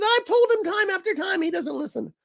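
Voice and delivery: high-pitched exasperated self-quote; retold exasperation. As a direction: replay your own fed-up reply, pitched high. Retelling own exasperated reply to wife.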